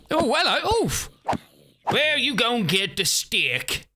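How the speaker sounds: high, swaggering Southern US lawman; Southern cop accent. Retold or imitated. Streamer voices her Southern cop roleplay character with a high swagger. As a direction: greet them like a smug Southern cop. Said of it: "I used to play a Southern cop".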